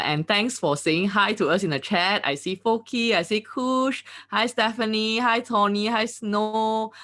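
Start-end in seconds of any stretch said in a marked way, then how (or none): none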